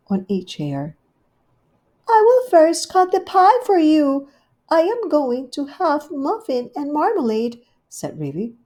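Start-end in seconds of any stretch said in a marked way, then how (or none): 2.1-7.6 much higher, louder gushing hostess; Rivy's voice. Acted. Rivy welcoming Duchess, high gushing hostess. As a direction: greet her sweetly and grandly.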